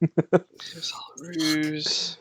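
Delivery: slow menacing whisper; slow theatrical villain menace. Pretend. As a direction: breathe it like a villain. Echoes villain line in whisper.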